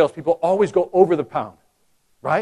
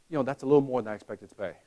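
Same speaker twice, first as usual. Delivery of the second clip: quiet, quick hesitant complaint; hesitant customer; 6 semitones lower, 8 dB quieter, faster than usual. Retold or imitated. Customer balking at the price, quiet and quick. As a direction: mumble the price worry. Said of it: "have somebody say".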